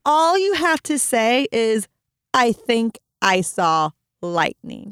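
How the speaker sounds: slow, spelled-out exasperated instruction; retold exasperation. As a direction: lay out each word for a stubborn child. Mother intervenes in children's argument.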